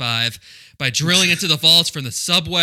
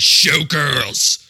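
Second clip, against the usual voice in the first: announcer title call; 2 semitones higher, 9 dB louder than usual. Retold or imitated.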